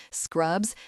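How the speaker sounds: ordinary delivery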